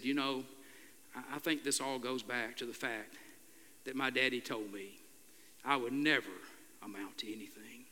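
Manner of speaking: soft, breaking voice that drops to a whisper at the end; tearful, choking voice. Retold or imitated. Pastor retells the young man's tearful confession, choked and hushed. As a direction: let the words choke off into a whisper. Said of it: "tears and a choking voice".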